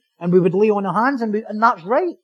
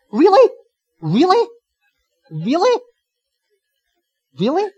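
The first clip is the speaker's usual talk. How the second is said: high, rising disbelief, loud at first; mock incredulity; 7 semitones higher, 4 dB louder than usual. Preacher questioning the congregation's honesty, mock incredulous. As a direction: keep querying them in exaggerated surprise.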